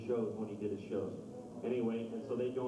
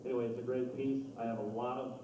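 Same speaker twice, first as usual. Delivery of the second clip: ordinary talk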